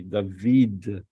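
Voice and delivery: David drawn out as "dah-VEED"; David with a long "ee" in the second syllable; Hebrew pronunciation. Shown as a demonstration. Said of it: "So this is in Hebrew".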